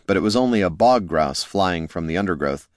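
plain reading voice

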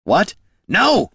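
high, loud urgent roar. A narrator's reading. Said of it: "roared Gorm".

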